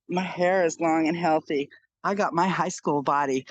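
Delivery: ordinary talk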